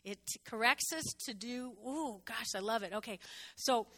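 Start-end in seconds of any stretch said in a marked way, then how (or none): none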